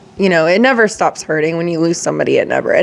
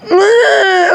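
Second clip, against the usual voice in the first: tearful sobbing sigh; 13 semitones higher than usual. Real, not acted. Crying after the memorial video.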